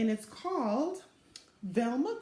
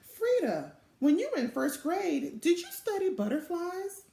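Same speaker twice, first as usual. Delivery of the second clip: put-on young Velma asking; 8 semitones higher than usual. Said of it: "Velma asked her older sister".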